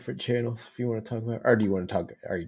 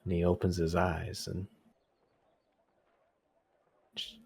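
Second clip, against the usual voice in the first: game master narration. Acted. GM describes the beach.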